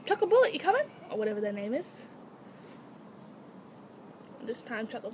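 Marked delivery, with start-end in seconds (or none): 0.0-0.9 high, lilting question to an animal; high pet-calling voice, rising. Shown as a demonstration. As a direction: demonstrate coaxing a pet, pitched up. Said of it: "just say".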